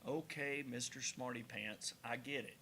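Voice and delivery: low, soft mock-grumpy retort; grudging concession. Real, not acted. Host corrected on geography, grudgingly conceding. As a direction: concede under your breath, sarcastically. Teased after wrong geography guess.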